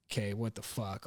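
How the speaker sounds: low, very soft retold grumble; muttered disbelief. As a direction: mutter it under your breath. Retold reaction to unanswered call.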